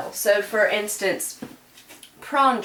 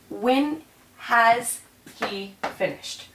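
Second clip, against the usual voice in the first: slow literal translation; much slower than usual. Shown as a demonstration.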